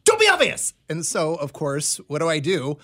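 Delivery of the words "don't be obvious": much higher, urgent mock warning; retold urgent warning. [name] repeating her warning to a friend, high-pitched urgent retelling. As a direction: squeak out the warning, imitating a panicky friend. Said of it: "she was like".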